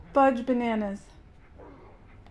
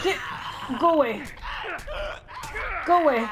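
urgent yell; 7 semitones higher than usual. Real, not acted. Player fends off game enemies, urgent yell. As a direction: drive them off with a short shout.

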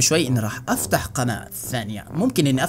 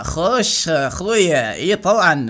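slow, drawn-out Iraqi dialect greeting; slow Iraqi "خوش اخويا"; Iraqi accent; 2 semitones higher, much slower than usual. Shown as a demonstration. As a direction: stretch it out like an Iraqi buddy. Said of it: "هل بتعرف تتكلم عراقي".

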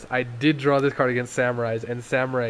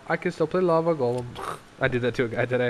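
normal talking voice

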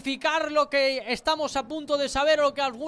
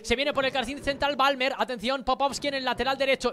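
ordinary talk, nothing marked

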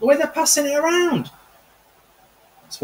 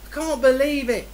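usual speaking voice

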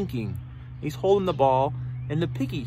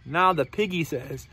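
plain reading voice

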